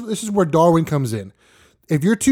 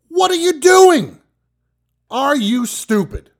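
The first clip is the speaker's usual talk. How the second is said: much higher, slow, disbelieving shout; incredulous yell; 11 semitones higher, 4 dB louder, slower than usual. Acted. Host yelling at the old woman with the napkin, outraged. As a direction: shriek it at her in disbelief.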